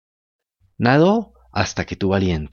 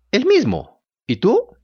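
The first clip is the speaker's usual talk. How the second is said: put-on high puppet boy voice; 10 semitones higher, 3 dB louder, slower than usual. A narrator's reading. Pinocchio's voice.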